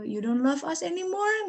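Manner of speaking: put-on child's worried question. Mother voicing daughter asking if she is unloved, mildly childlike. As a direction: mimic the daughter's hurt little question. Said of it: "terus dia tanya".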